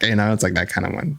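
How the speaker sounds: usual speaking voice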